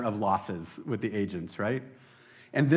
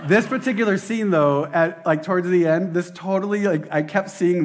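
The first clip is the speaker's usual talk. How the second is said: rising, louder, eager build; pitched up with real excitement; excited; 5 semitones higher, 6 dB louder than usual. Real, not acted. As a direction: gush about the scene. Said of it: "I'm getting a little excited".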